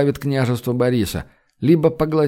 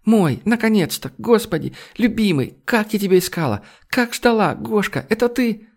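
high, breathless-with-joy woman's outpouring; overjoyed lover gushing in a much higher voice; blurted out; 9 semitones higher than usual. A narrator's reading. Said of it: "выпалила Ольга". (восторженно, взахлёб, высоким голосом)

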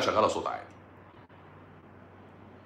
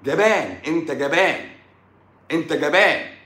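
high, punchy repeated jeer; taunting accusation; 4 semitones higher, 3 dB louder, slower than usual. Real, not acted. Taunting a fearful patient as a coward, jeering. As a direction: throw the insult out high, over and over.

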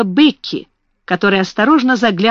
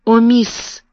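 ordinary delivery, nothing marked

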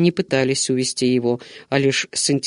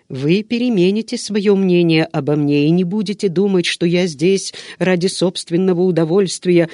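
plain reading voice

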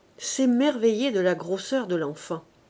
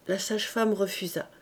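ordinary delivery, nothing marked

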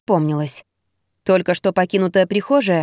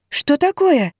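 startled, higher frightened question; 7 semitones higher, slower than usual. Acted. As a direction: jump with fright. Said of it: "испугалась я". (испуганно)

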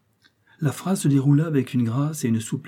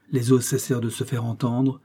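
ordinary delivery

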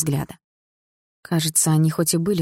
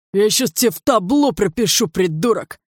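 loud, higher thug yell; 6 semitones higher, 4 dB louder than usual. Acted. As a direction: threaten him at full shout. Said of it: "заорал один из гопников".

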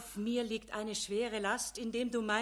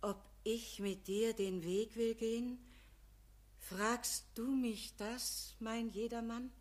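tender frail voice; 8 dB quieter than usual. Acted. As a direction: ask him softly, full of pity. Frail Good Deeds speaks tenderly.

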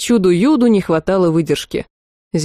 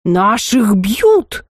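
very slow, odd-voiced call; a hint of a wolf howling for backup; wolf's rallying cry; much slower than usual. A narrator's reading. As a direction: drag out the cry to rally the pack. Gray wolf calling for help.

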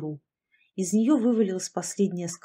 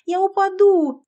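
very high, loud shriek; panicked scream; 12 semitones higher, 9 dB louder than usual. A narrator's reading. Narrator slips off Sasha's shoulders, screaming in fright. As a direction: cry out in sudden panic. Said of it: "завопила я".

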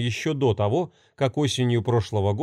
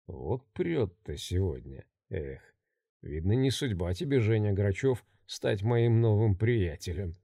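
mocking; 5 semitones lower, 7 dB quieter than usual. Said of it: "с насмешкой рассматривая".

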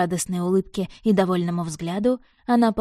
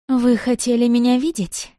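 higher, louder coquettish purr; breathy vintage purr; 4 semitones higher, 4 dB louder than usual. A narrator's reading. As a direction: purr the question, inviting. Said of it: "промурлыкала леди".

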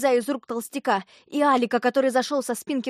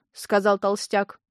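ordinary delivery

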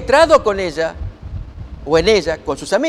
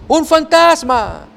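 high, slow mock-fearful cry; frightened cry; 8 semitones higher, 4 dB louder, much slower than usual. A narrator's reading. Disciples seeing Jesus on the sea, crying out in mild mock fear. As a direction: gasp it out as if seeing a ghost. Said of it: "dieron voces de miedo".

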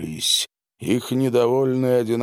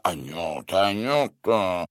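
reproachful; 2 semitones lower than usual. A narrator's reading. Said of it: "укоризненно качал головой".

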